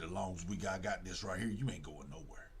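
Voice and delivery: voiced killer, low and quiet menace; low menacing threat. Husband holds the note over wife, low menace.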